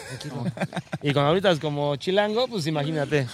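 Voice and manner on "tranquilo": soft, low-key referee voice; retold calm reply; quiet calming. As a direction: hush someone with one soft word. Acts referee's mild reply.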